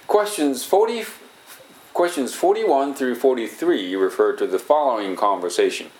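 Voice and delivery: slow, clear English exam narrator read; test narration read. Shown as a demonstration. Recording a TOEIC test audio, slow formal narrator read. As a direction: announce the question like a TOEIC recording. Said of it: "とかいうのを、録音したんですよね".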